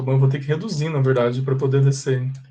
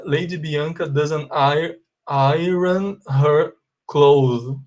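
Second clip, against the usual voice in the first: slow model reading; much slower than usual. Shown as a demonstration. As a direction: read very slowly, word by word, ending on "clothes". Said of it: "Então a gente fala assim". (leitura modelo bem lenta)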